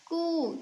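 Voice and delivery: कू with the oo drawn out; strong long oo. Shown as a demonstration. Teacher reads k with big oo, long and drawn out. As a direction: hold the vowel in कू long and steady. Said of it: "ऊंचे स्वर में पढ़ा जाता है".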